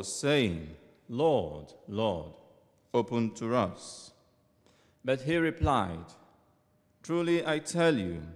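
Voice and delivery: solemn proclaimed scripture; gospel reading. Acted. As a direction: proclaim it like a church lector.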